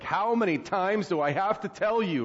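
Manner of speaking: mock parental frustration; exasperated parent. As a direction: scold like a parent out of patience.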